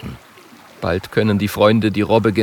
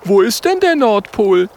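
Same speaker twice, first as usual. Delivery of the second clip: high, loud little character voice; high-voiced, eager young friend; curious Oskar figure; 11 semitones higher, 5 dB louder than usual. A narrator's reading. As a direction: pipe up with a question.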